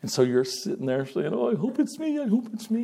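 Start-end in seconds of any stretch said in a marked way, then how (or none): 1.3-2.8 high, softer eager pleading; anxious hoping. Acted. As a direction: quietly wish aloud to be picked, voice pitched up. Voices a hopeful candidate waiting.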